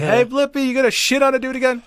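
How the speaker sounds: high, loud mocking heckle; heckling. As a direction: call out to mock him from the crowd. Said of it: "I'm gonna fucking heckle him".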